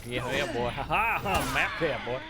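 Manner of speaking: put-on good ol' boy hollering; Southern accent. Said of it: "That comes way too natural to you".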